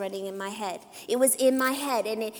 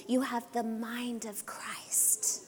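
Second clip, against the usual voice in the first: slow, soft, dropping toward a whisper; hushed emphasis; 10 dB quieter, slower than usual. Real, not acted. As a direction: let it sink in, slowly and very quietly. Hushed close of a reassurance.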